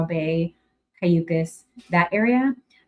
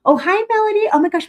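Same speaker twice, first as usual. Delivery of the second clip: excited greeting; 12 semitones higher, 4 dB louder, much faster than usual. Real, not acted. Greeting a viewer on a livestream.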